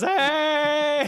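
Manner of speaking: high, drawn-out battle yell; acted scream. Game master showing repeated fire breaths, high scream. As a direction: holler it high as if spewing fire. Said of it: "like, screaming fire everywhere".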